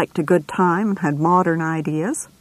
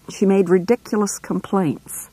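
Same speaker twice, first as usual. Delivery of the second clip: ordinary delivery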